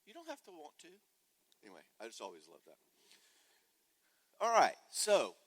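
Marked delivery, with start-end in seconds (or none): 0.0-1.0 much higher, sweetly calm; the office manager soothing her kids, sugar-sweet and high; sweet mom voice. Retold or imitated. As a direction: coo it like a gentle mother. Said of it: "And she said, I would always say to 'em".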